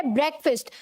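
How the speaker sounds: ब्रेकफास्ट as "BREK-fust"; short e and reduced second vowel in ब्रेकफास्ट; correct pronunciation. Shown as a demonstration. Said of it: "क्या है?".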